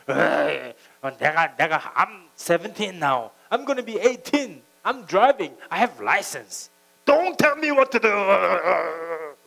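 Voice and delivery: put-on loud, stretched-out whiny teen protest; rebellious teen. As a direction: drag out each word like a sulky seventeen-year-old. Voicing the teenage son's protest. (느리고 크게 투덜대는 십대 아들 흉내)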